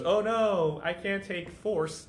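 high, drawn-out mock dismay; laser's mock distress. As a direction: play a machine melodramatically giving up, half-laughing. Voices the laser giving up.

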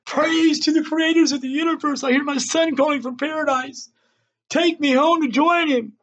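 high-pitched ecstatic cries to the sky; screaming. A narrator's reading. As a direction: cry out to the heavens in rapture. Father crying out to the sky.

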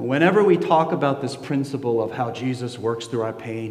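ordinary talk, nothing marked

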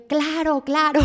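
high, excited double yes; eager agreement. Retold or imitated. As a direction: jump at the idea, delighted. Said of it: "Yo dije".

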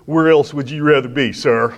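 upbeat Marine, barking it out; eager young soldier voice; loud gung-ho soldier. Retold or imitated.

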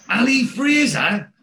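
high, loud, drawn-out incredulous question; shocked disbelief. Retold or imitated. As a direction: echo the name in loud astonishment. Neville's shocked echo of the name.

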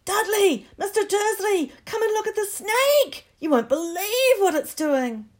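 put-on high, excited yelling kid; deafening shout. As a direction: bellow it across the room. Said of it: "a deafening shout behind Harry".

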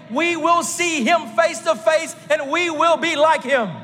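rising, shouted sermon climax; preaching at full force. Acted.

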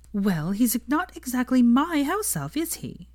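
a prim, prissy retort. Acted. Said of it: "said Hermione primly".

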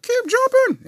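very high, loud barked orders; mock drill-sergeant shout. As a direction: yell at recruits in a hammed-up voice. Sergeant yells at fallen soldier.

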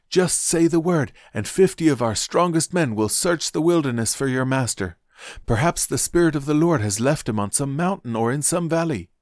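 high, eager pleading; urgent appeal. A narrator's reading. Prophets begging Elisha to allow a search, urgent appeal.

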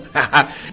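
brief amused laugh; a quick real chuckle; short laugh. Real, not acted. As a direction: laugh at the story. Laughs at own anecdote.

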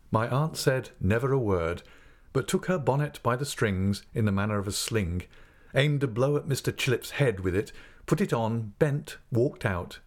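ordinary delivery

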